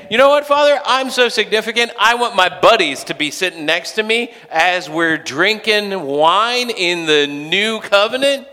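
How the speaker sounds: high, loud, boastful plea; mock self-importance. Jesus imagined asking his Father for favors, mock self-important. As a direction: brag excitedly as a mock demand.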